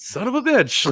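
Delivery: high, loud frustrated outburst; exasperated curse. Retold or imitated. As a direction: blurt it out in shock. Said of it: "And I was like".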